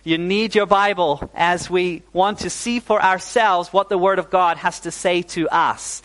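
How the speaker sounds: normal talking voice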